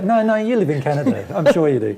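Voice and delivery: playfully pigheaded, laughing; laughing, stubborn insistence. Pretend. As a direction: insist cheerfully through a laugh. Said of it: "And I go".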